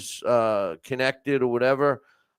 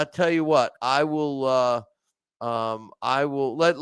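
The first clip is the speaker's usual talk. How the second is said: usual speaking voice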